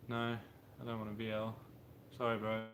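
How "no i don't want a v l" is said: normal talking voice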